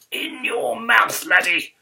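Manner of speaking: loud, high, drawn-out teacher's bellow; theatrical roar of an exasperated schoolmaster; exasperated shout. Acted. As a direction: bellow slowly at a silly pupil. Exasperated teacher answers pupil.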